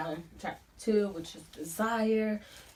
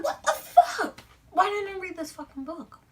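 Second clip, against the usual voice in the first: much higher, louder disbelieving cry; stunned outburst; 13 semitones higher, 8 dB louder than usual. Retold or imitated. Finally reading the book, high shocked outburst at herself. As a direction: shriek it like you can't believe you missed it.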